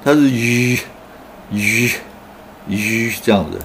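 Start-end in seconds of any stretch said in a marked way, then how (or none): 0.3-0.8 "吁" sounded as a buzzing voiced zh; zh sound demo. Shown as a demonstration. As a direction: hold a buzzing zh on "吁". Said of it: "这个音很难念哦".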